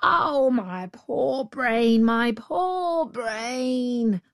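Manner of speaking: very slow, drawn-out lament; hammed-up distress; wailing jackal. A narrator's reading. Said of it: "cried the jackal, wringing its paws".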